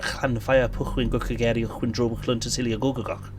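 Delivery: Llanfair Pwllgwyn Gwcageri Chwyndro Clwnt Cecilia Gogagach rattled off with Welsh ll and ch sounds; showing off Llanfair Pwllgwyn Gwcageri Chwyndro Clwnt Cecilia Gogagach with throaty Welsh sounds; Welsh place name. Shown as a demonstration. Showing chat the longest place name, Welsh pronunciation. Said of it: "I can also pronounce".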